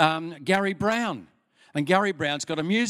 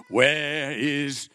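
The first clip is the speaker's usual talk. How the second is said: booming priest; 3 dB louder, much slower than usual. Acted. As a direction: boom it through a cathedral. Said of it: "he boomed in the cathedral".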